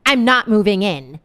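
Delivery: loud flat refusal; nasty lady refusing to move, shouting; mock shouting. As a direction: bark the no's at her. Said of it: "she said".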